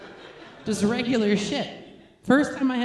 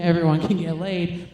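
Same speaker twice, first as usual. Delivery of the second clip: ordinary talk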